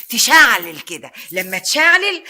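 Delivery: loud, drawn-out blaze; fiery build. Acted. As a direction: make the word burn. Jealousy flaring like fire.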